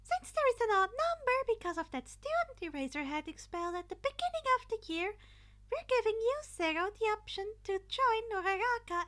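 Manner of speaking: high, loud chirpy announcing voice; cheerful principal. A narrator's reading. Principal announcing the teams to the class, high cheerful call. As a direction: announce it like a bubbly host.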